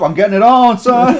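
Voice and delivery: high, loud, laughing shout; loud mock dad shout. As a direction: yell it at the kid in mock annoyance. Acting out a dad scene.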